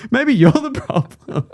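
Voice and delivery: slow, high teasing drawl; mock accusing, slow and high. Stacker teasing the bumped lady, slow high mock accusation. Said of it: "I was like".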